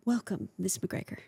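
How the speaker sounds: a hint of a formal receptionist welcoming guests; hotel staff greeting the scruffy arrivals, politely hushed; posh hotel greeting. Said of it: "they were like".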